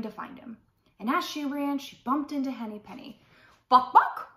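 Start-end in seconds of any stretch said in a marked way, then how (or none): 3.7-4.3 high, loud cartoon hen clucks; clucking hen. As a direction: squawk it like a startled hen. Voices hen Henny Penny clucking.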